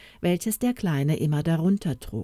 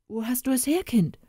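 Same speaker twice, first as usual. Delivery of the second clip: pressing, higher and insistent; urgent; 7 semitones higher, faster than usual. A narrator's reading. Father urging the boy to tell him quickly. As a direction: demand it, impatient to know. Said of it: "Sag es mir geschwind".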